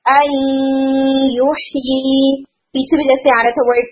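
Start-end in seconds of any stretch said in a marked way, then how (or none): 0.0-2.5 "युही" drawn out very slowly, nasalised; slow recitation with a long nasal hold; nasal ghunna. Shown as a demonstration. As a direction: hum the merge in "आई युही" through the nose. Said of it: "नाकस गुन्ना महसूस हो".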